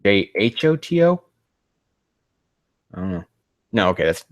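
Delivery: normal talking voice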